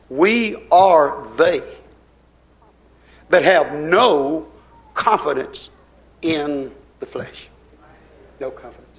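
slow, weighty recitation of the verse; solemn scripture reading. Acted. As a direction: lay each word down slowly like a sacred text.

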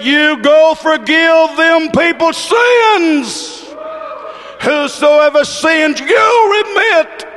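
slow, high preacher's shout; impassioned preached shout. Retold or imitated. Preacher voicing Jesus granting power to forgive, slow ringing shout. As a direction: hammer out the command, high and drawn out. Repeated command at full preaching force.

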